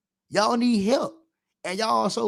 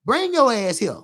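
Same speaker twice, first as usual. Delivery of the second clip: higher, louder scolding that builds; scolding a girl; 3 semitones higher, 6 dB louder than usual. Retold or imitated. A woman scolding a fast little girl, rising and louder. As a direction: call her over and chew her out. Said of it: "women gotta step down on these little girls".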